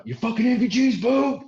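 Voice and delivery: high, furious shouted insult; screaming. Retold or imitated. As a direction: scream it like a Marine berating the new guy. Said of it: "everybody's screaming at me".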